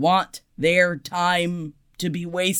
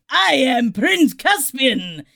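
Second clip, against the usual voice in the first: put-on slow, grand Spanish-accented Antonio Banderas voice; desperado accent; 6 semitones higher, 3 dB louder, slower than usual. Actor retelling his audition in a slow Spanish-accented desperado voice. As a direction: ham up a Spanish accent as Caspian. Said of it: "saying in a desperado voice".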